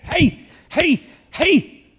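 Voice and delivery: high, loud, punchy bursts; forceful high-pitched "hey" shouts; exertion shouts. Real, not acted. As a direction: shout each hey sharply as if showing off a feat. Showing off fitness at nearly eighty.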